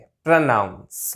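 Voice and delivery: slow, low प्रोनाउंस with short 'pruh'; correct pronunciation. Shown as a demonstration. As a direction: model प्रोनाउंस as pruh-NOUNS. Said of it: "like that". (छोटे 'प्र' के साथ 'प्र-नाउंस')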